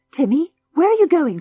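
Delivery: calling out high and loud after the dog; cry out. A narrator's reading. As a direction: shout after Timmy down the tunnel. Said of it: "cried George".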